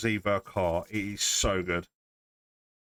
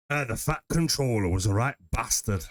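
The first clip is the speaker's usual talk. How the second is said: put-on lightly done Northern English accent; a hint of Sean Bean's Northern voice. A Southerner trying a light Northern accent on the Fat Controller. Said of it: "doing a Northern accent".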